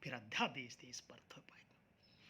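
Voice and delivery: low, whispery repeat of the question; hushed echo. Real, not acted. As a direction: ask it under your breath.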